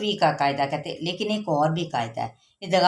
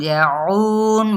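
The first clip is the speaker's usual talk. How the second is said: slow recitation; 2 semitones higher, 4 dB louder, much slower than usual. Shown as a demonstration. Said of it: "models word slowly".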